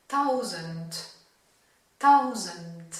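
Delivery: "tausend" ending in a crisp T; final D as T. Shown as a demonstration. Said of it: "le D à la fin va plus se prononcer comme un T". (« tausend » avec le D final prononcé T)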